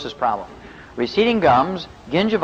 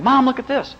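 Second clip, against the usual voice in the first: put-on high-pitched kid calling out; calling to mom; 11 semitones higher than usual. Fourteen-year-old showing mom his twitching eyelid, high call.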